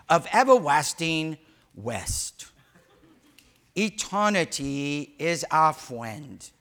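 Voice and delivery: slow, dreamy, solemn recitation; dramatic movie quote. Retold or imitated. Preacher quoting a favorite movie, slow and grand. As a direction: intone it like a grand romantic film line. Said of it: "I'm gonna quote one of my favorite movies".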